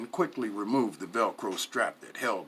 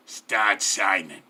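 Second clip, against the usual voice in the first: louder, slow, firm gangster command; curt order; 3 semitones lower, 6 dB louder, slower than usual. A narrator's reading. Mafia man orders captive to sign, slow firm command. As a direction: lay the order down slowly and firmly. Henchman hands over pen.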